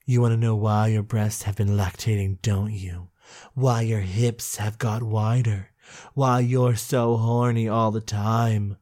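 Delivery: breathy villain-like tease; sultry low voice. Acted. Reading the book's seductive dialogue.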